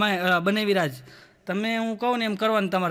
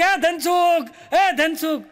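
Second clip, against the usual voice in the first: calling out; 4 semitones higher, much slower than usual. Retold or imitated. Uncle calls from departing train.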